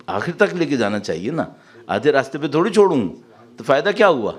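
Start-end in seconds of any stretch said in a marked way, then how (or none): none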